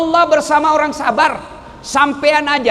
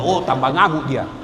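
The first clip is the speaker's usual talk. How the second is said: usual speaking voice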